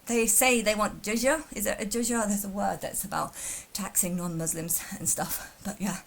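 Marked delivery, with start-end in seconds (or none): none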